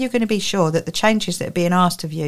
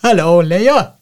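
put-on Welsh accent; 6 dB louder, slower than usual. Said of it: "my Welsh Jesus".

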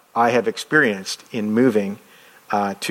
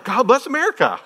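laughing exclamation; 12 semitones higher than usual. Retold or imitated. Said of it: "I'm like".

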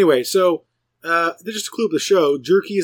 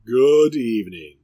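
announcer voice; 3 semitones lower, 4 dB louder, slower than usual. Pretend. Mock announcer sign-off.

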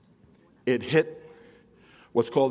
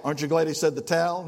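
ordinary talk

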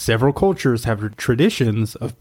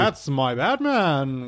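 put-on old person voice; 3 semitones higher, much slower than usual. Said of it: "Were you talking like this?".

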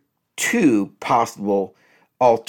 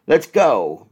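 normal talking voice

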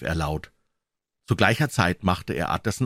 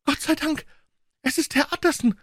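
put-on much higher, thin old woman's cry of relief; relieved old cook. A narrator's reading. Old cook rushes to the lawyer, high relieved cry. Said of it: "rief die alte Köchin".

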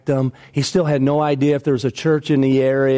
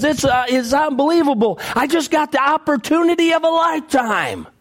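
thrilled man shouting his good fortune to his preacher; excited news; 10 semitones higher than usual. Retold or imitated. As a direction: burst out with the big news like it's a miracle. Man brings big news to pastor.

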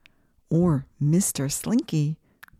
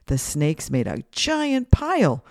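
ordinary delivery

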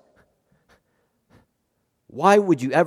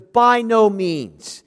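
by no means, each word slowly leaned on; emphatic denial; 4 semitones higher, much slower than usual. Retold or imitated. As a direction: stretch every word out in flat refusal. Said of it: "written in the emphatic".

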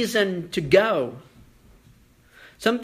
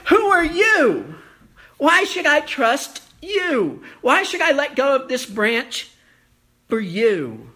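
pleading challenge; 7 semitones higher, 4 dB louder than usual. Acted. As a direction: press God for an answer. Moses' doubt voiced loudly.

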